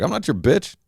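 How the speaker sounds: high-pitched offended retort, imitated; mock indignant woman. Woman refusing his water request, mock indignant.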